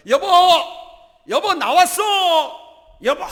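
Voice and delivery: very high, loud call to a wife; boisterous shouting. Acted. Husband arriving home with soup for wife, shouting boisterously. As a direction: holler proudly that you are home. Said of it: "막 소리치면서".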